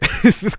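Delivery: sudden high loud laugh; burst of laughter. Real, not acted. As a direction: crack up at your own joke. Laughs at own joke.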